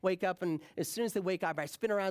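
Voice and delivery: normal talking voice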